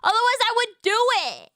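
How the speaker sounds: high, loud frustrated protest; exasperated outburst. Real, not acted. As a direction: burst out in flustered protest. Answering how to devour an accent.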